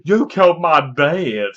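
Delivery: slow, lightly acted hero line; hammed-up grieving son; mock dramatic accusation. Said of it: "He goes".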